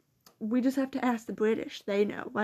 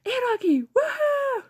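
retold yell; 12 semitones higher, 4 dB louder than usual. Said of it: "He also yelled".

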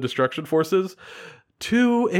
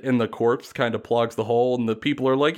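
ordinary talk, nothing marked

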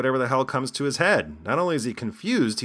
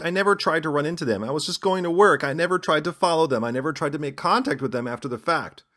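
higher and louder, protesting his innocence in mock earnest; mock defensive pleading; 7 semitones higher, 5 dB louder than usual. Host imitating the arrested man's denial, in a mock pleading voice. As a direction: lightly play the accused man whining he meant no harm. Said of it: "He claimed that he never meant any harm".

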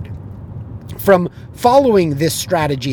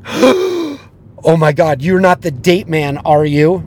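slow, stretched, louder disbelief; shocked realization; 5 dB louder, slower than usual. Retold or imitated. Girlfriend discovering her date is the heckler, stunned disbelief. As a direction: draw out the shock.

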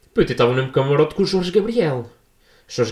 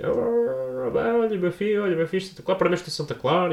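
mock praise; 8 semitones higher, 3 dB quieter than usual. Rival fans congratulating him.

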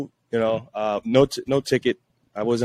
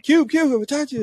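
excited fan. Retold or imitated. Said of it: "I'm like".

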